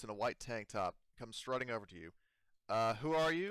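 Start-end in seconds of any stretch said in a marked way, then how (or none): 2.7-3.5 higher, louder suspicious colonist; character voice. Acted. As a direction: call out wary and sharp. GM voices colonist greeting crew.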